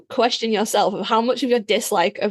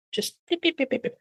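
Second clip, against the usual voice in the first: mock beeping; 7 semitones higher, 6 dB quieter than usual. Mimicking torture machine sounds.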